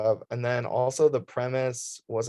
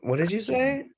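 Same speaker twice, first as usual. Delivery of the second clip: high mock question; 12 semitones higher than usual. Mock reaction to the cue.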